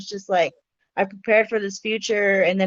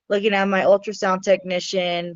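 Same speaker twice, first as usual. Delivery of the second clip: ordinary talk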